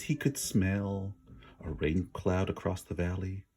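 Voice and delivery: ordinary delivery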